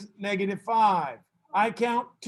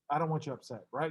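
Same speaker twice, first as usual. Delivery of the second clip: reassuring; 6 semitones lower, 8 dB quieter, faster than usual. Real, not acted. Teacher reassuring an upset student.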